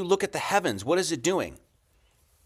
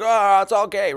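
louder, scornful mock dismissal; mock scoffing; 4 semitones higher, 7 dB louder than usual. Lecturer mimics critics dismissing the ritual, jeering mock scoff. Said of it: "it's like".

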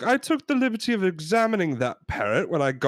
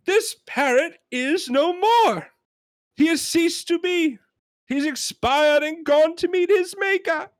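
high-pitched exasperated rant; hammed-up outrage, every phrase hammered out high; John Cleese yelling; 3 dB louder than usual. Customer ranting that the parrot is dead, exasperated high yell. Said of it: "and he yells a lot".

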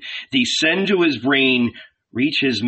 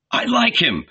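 higher-pitched, keen character voice; enthusiastic cobbler; 6 semitones higher than usual. A narrator's reading. Cobbler tells his shepherd friend, enthusiastic.

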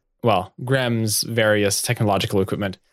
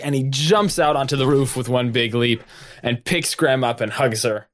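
usual speaking voice

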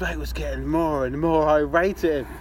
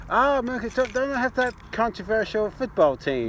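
high-pitched imitation of a stranger; curious stranger; 7 semitones higher than usual. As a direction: play an intrigued outsider, pitched up. Retold stranger reacting to hometown.

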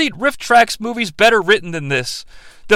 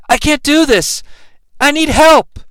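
higher-pitched mock plea for help; overwrought plea; 8 semitones higher than usual. Critic quoting Aang's outburst at the river, mock distress. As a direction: deliver it as a hammed-up movie cry for help. Acted movie line of despair.

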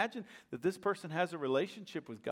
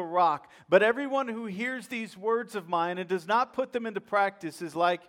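louder, rising preacher's cadence; full-force preaching; 5 dB louder than usual. Acted. Preacher proclaiming the wise builder's story to the congregation. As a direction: thunder it from the pulpit.

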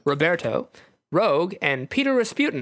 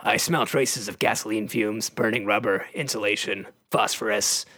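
Wolverine; 7 semitones lower than usual. Acted. Wolverine's line, voiced low.

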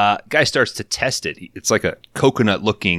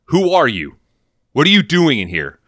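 much higher, louder mock-challenging yell; mock yell at an intruder in the basement; 7 semitones higher, 4 dB louder than usual. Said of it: "I just started to yell that".

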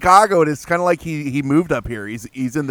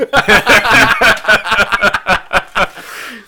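loud laugh; 7 semitones higher, 7 dB louder than usual. Real, not acted. Laughing at own joke.

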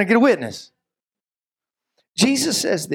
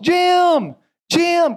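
high, drawn-out call through the woods; raised search-party shout; 11 semitones higher, 3 dB louder than usual. Acted. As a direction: holler the name for someone lost. Searching for lost Jim in woods.

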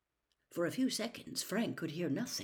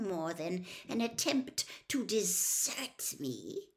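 raised, deliberate villain voice; cold master, suspicious; 6 semitones higher, 8 dB louder than usual. Acted. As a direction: probe with mounting accusation, loud and pointed. Cold master suspects his servant.

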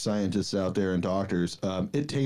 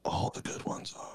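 whispering; 9 dB quieter than usual. Real, not acted. Whispered reply.

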